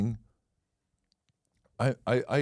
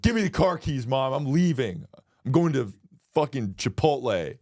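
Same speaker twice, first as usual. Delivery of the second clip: bratty demand; 6 semitones higher than usual. Pretend. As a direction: shout it like a storming teen.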